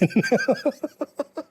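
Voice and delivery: high breathy giggle; high laugh. Real, not acted.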